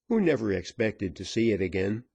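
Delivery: plain reading voice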